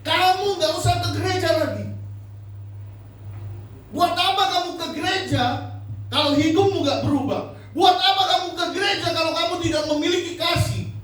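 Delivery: retelling angry scolding. Retold or imitated. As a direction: scold them, retelling your anger. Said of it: "Saya marah, saya bilang". (menirukan teguran marah dengan nada tinggi)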